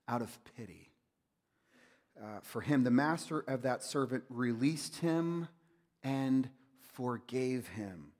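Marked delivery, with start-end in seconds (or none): none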